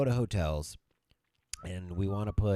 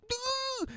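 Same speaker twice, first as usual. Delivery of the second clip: very high startled yelp; mugger yelp. Retold or imitated. Husband mimics the fleeing mugger, very high yelp. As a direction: squeak like a scared mugger. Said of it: "the guy goes like".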